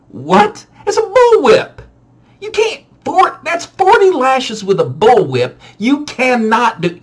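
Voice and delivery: high, loud disbelief at a dare; shocked big-brother protest; incredulous high protest. Retold or imitated. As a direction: squeal it's impossible. Arguing with brother Scott.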